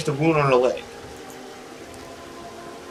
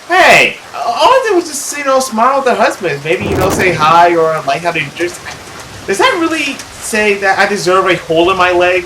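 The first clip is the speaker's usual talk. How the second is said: put-on indignant girl; 2 semitones higher, 4 dB louder than usual. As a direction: protest in a raised girlish voice.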